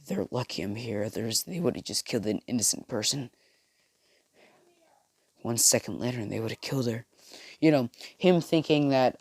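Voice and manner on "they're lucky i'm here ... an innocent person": grunted mutter. Retold or imitated. Deku grumbling to himself after saving the girl, softer. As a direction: grumble it to yourself, annoyed. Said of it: "as he grunts and says".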